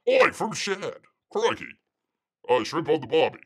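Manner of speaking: loud, hammed-up Aussie exclamations; Australian accent. Host greeting Shad's comment, in a loud mock Australian accent. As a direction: throw out Oi and Crikey like a caricature Aussie.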